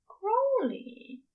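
high and choked, breaking on the name; moved angel, voice catching; tearful name. Acted. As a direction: say his name as if near tears. Aziraphale calls his name, moved.

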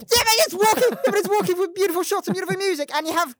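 loud, high, insistent protest; heated defence. Real, not acted. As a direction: shout over them to defend it. Shouting back, defending the films.

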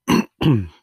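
a sharp, loud clearing of the throat; quick loud throat clear. Real, not acted. Throat clearing mid-review.

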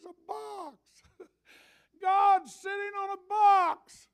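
loud, high, slow preacher's shout; hollering from the pulpit; full-force preaching. Acted. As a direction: preach it out with rising fervour.

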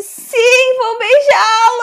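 hammed-up sob on beijá-lo; mock tearful. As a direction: say yes as if it breaks your heart. Dramatizing the girl's reply.

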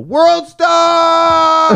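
high, loud yell; yelled catchphrase. Retold or imitated. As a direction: shout it like a hype call. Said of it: "you yell".

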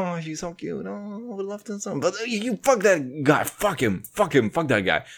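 hushed fake swoon turning to soft curses; imitating his woman. Podcaster voicing his woman torn between love and anger, soft mock voice. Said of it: "But at the same time".

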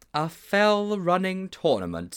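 slow incredulous scolding; appalled disbelief. Acted. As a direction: repeat it with weighty reproach, drawing it out. Mildew appalled at Sponge.

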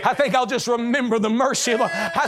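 high, rising revival cadence; fervent preaching. Real, not acted. Preacher rousing the congregation to remember mercy, fervent preaching. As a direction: preach it with mounting force. Preacher rises to full force.

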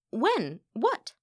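startled confusion; mystified. A narrator's reading. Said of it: "Delphine was still mystified".

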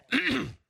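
a quick rough clear of the throat. Real, not acted. Pastor clearing his throat before the congregation. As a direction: clear the frog from your throat mid-sermon. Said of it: "Got a frog in my throat".

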